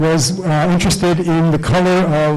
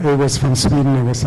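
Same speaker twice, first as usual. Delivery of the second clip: ordinary talk, nothing marked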